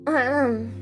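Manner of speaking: ordinary talk, nothing marked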